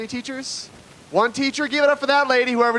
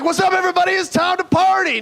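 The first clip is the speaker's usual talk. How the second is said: party yell; 9 semitones higher than usual. Retold or imitated. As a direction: burst in ready to party. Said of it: "We're like".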